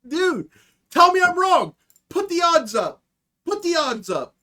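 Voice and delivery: hyped shouting at the audience; excited challenge. Real, not acted. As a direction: dare them to prove you wrong.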